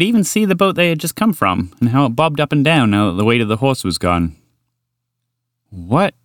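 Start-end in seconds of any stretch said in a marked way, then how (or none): 5.7-6.1 short, rising startled question; blurted. Acted. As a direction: blurt it out with a touch of surprise. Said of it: "Walter blurted".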